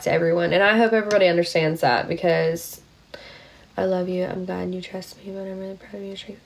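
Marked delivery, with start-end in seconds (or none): 3.8-6.4 much softer, sincere reassurance; tender and soft. Real, not acted. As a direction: hush down to comfort a friend who confided. Quiet tender words to friend.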